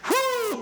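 high happy whoo cry; joyful whoop. Shown as a demonstration. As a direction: let out a celebration whoop. Rejoicing shout example.

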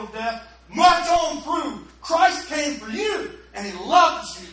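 emphatic, rising preacher cadence; preaching. Real, not acted. Pastor urging the congregation, emphatic preaching. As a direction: drive home the grace message.

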